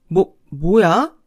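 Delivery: slow, shocked stammer; the stunned mother-in-law sputters back at her. Acted. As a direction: caught off guard, sputtering. Shocked reply to her rebuke.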